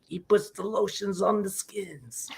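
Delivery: slow, soft, eerie villain voice; creepy movie villain. Pretend. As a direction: drag it out like a creepy captor.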